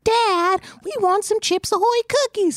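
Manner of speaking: put-on high childish whine begging dad; whiny kids. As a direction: beg like a little kid. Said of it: "the kids are like".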